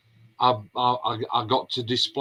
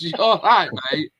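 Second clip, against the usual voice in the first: higher, louder mock-hearty hello; louder retold greeting; 5 semitones higher, 4 dB louder than usual. Mark greeting the Scottish fan, louder and higher. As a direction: greet him loudly with a laugh.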